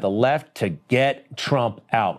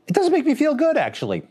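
mock hurt; 13 semitones higher than usual. Mock hurt aside about Oscars remark.

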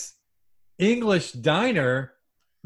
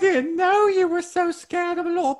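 much higher falsetto of a prim British nanny; a fussy Scottish-nanny falsetto, teasing; Mrs Doubtfire impression; 13 semitones higher than usual. [name] teasing his scared travel partner, falsetto Mrs Doubtfire. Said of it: "put Missus Doubtfire away".

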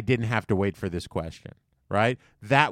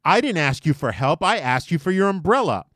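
higher and louder, correcting him with indignation; indignant retort; 6 semitones higher, 5 dB louder than usual. Retold or imitated. Homeless woman correcting the narrator, indignant and raised. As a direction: insist she asked for the umbrella, not help. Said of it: "And she goes".

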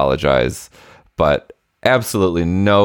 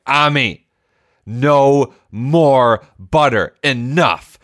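stern, slow, higher and harder, laying down the law; stern scolding; 8 semitones higher, 3 dB louder, much slower than usual. Retold or imitated. Dad losing patience with his son over butter, stern slow scolding. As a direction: drag out each word like a fed-up parent. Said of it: "I was monstrous in that moment".

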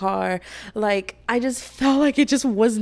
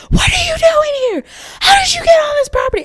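full high-pitched shout; piercing retold police interrogation yell; genuine yelling, imitated; 9 dB louder than usual. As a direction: scream it like an enraged officer. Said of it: "they were genuinely yelling".

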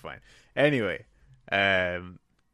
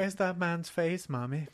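put-on child voice; 8 semitones higher than usual. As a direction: ask it like a spooky toddler. Mimicking creepy toddler.

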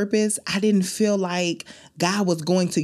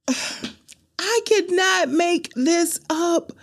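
awed disbelief; 12 semitones higher, much slower than usual. Real, not acted.

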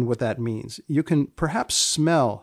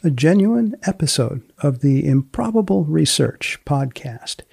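louder, punchy announcer read; podcast outro; 5 dB louder than usual. Acted. Host signing off the Improbable Research podcast, loud announcer voice. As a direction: sell the sign-off like a radio host.

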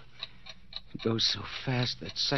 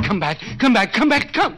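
building from loud to screaming, much higher; rising screams; 10 semitones higher, 7 dB louder than usual. Acted. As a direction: scream after them louder each time. Calling after departing burglars.

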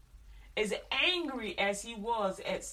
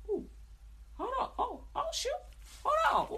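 much higher, yelping "hold on"; child in pain. Retold or imitated. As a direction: yelp like a hurting kid. Child voice, pained, surprised.

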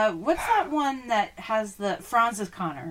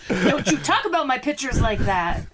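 higher, louder mock-protective scold with a laugh; protective Mama Bear Jerry; 6 semitones higher, 8 dB louder than usual. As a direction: playfully scold like a mother bear guarding her cubs. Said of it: "Protective Mama Bear Jerry".

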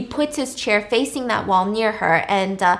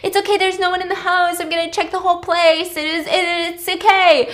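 high, hurried reassuring; calm someone down in a raised, rushed voice; urgent reassurance; 8 semitones higher than usual. Retold or imitated. Acting him checking the house for Claire, urgently reassuring.

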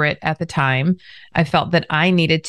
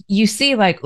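ordinary talk, nothing marked

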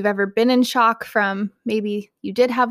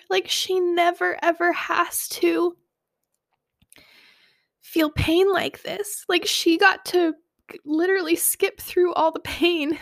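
higher voice breaking with real tears; tearful; 6 semitones higher than usual. Real, not acted. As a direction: get the words out slowly through crying. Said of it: "excuse my sniffles and my tears".

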